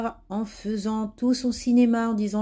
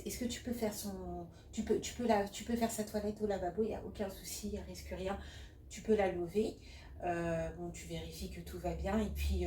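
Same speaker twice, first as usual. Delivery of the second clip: quiet, rushed phone call; a hungry nurse hurrying off on break; 14 dB quieter than usual. Acted. Nurse phoning a colleague.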